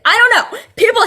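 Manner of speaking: high, loud fed-up outburst. Real, not acted. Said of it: "I'm just fed up with it".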